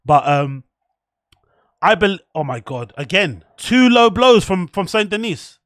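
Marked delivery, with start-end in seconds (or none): 3.0-5.6 shocked outburst. Real, not acted. Reacting to low blow live.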